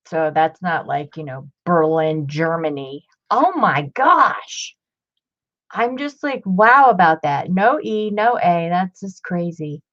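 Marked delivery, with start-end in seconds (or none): 3.3-4.8 amazed. Real, not acted. Amazed at the book's inscription.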